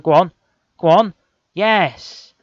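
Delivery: high, loud urging then a cheer; real goal celebration; excited cheering. Real, not acted. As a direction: roar the striker on. Urging striker, goal scored.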